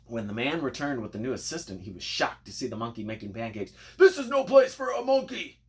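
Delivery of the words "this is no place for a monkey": high, much louder furious shout; angry yell. A narrator's reading. Griddle man yells on finding the monkey at his griddle, furious. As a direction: bellow it at the monkey. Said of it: "he yelled".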